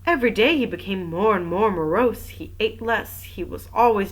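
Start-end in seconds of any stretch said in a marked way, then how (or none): none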